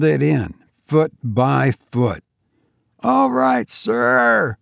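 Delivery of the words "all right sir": high bright call of relief; high cheerful call; cheerful cry. A narrator's reading. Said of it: "cried George cheerfully".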